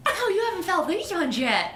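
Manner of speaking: high put-on character voice; in-character boast. Player turning to another character to offer hands, high character voice. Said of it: "I turn towards you and I'm like".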